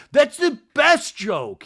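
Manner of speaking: high, loud, drawn-out hammed-up cheer; slow, booming mock excitement; mock enthusiasm. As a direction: exaggerate a friend's over-the-top delight. Invented over-the-top reply from friend.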